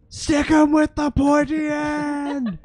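high, drawn-out yell, very loud; crowd shout. Acted. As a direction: holler it over the arena. Crowd yelling during arena fight.

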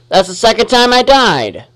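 ordinary talk

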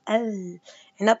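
العنب drawn out very slowly, l clearly sounded; al-'inab, stretched syllable by syllable; teacher sounding out العنب for kids; slow clear moon lam. Shown as a demonstration. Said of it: "شوفوا كيف لفظتها".

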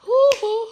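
high, loud "hoo hoo"; playful falsetto hooting. Real, not acted. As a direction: hoot twice in a high squeal. Playful noise after pet names.

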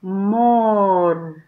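"Amor" stretched long and slow; drawn-out reveal. Shown as a demonstration. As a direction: draw out "Amor" as you reveal it. Slowly reveals the chosen word.

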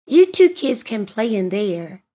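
ordinary delivery

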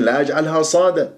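usual speaking voice

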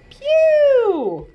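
high loud pew at a smell; mock stink cry. Reader reacting to smelly sardines, high mock disgust.

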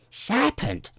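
high, piercing cry of accusation; storybook scream, very high-pitched. A narrator's reading. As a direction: screech it as an alarmed bird. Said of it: "screamed the pigeon".

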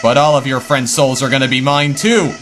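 a taunting little villain who turns mocking and menacing; gloating villain flower. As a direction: sneer at them as the flower who wins every game.

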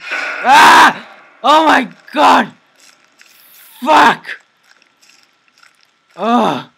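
real panicked yell at a jump scare; terrified scream. Real, not acted.

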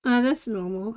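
normal talking voice

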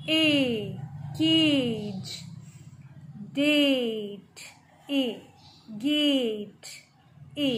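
ए held long alone and inside केज, डेट, गेट; long vowel. Shown as a demonstration. Teacher isolating the long A for pupils, ए stretched in केज, डेट, गेट. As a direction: draw out every ए, in केज, डेट, गेट too. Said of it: "लंबा खींच रहे हैं थोड़ा".

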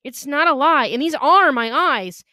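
high, emphatic denial; desperate protest. A narrator's reading. Boy accused of lying at knifepoint, protesting urgently.

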